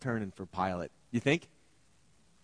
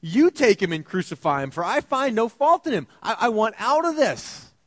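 a louder, higher-pitched Pilate pushing them away; 8 semitones higher, 8 dB louder than usual. Acted. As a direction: loudly hand the problem back to the crowd. Preacher voices Pilate washing hands.

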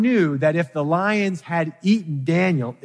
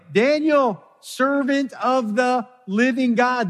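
lamenting cry; 9 semitones higher, 3 dB louder, slower than usual. Retold or imitated. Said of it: "cried out with a lamenting voice".

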